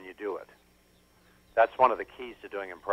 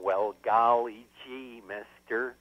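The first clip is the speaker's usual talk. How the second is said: Jack Nicholson; 4 dB quieter, slower than usual. Retold or imitated. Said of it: "Jack is saying".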